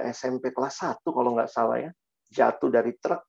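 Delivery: ordinary talk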